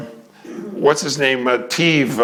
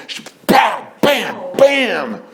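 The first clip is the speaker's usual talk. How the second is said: punchy raised bam bam bam; mock slap sounds; 10 semitones higher, much slower than usual. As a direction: hit each bam like a slap. Retelling slaps with sound effect.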